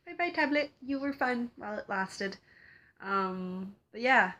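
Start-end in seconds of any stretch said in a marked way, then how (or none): 0.1-2.4 high-pitched little mock goodbye; playful farewell. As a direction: say goodbye to a gadget in a small high voice. Mock farewell to her tablet.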